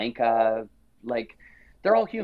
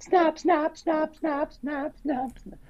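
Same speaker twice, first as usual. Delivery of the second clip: camera shutter noise; slower than usual. Retold or imitated. Mimicking camera shutter at dinner.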